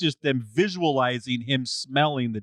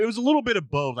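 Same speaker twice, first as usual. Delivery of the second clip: normal talking voice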